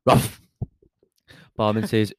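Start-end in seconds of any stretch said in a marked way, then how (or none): 0.1-0.4 short, sudden imitation bark; one-syllable mock woof; dog bark. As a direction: say "Rough" like a dog barking. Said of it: "The dog goes".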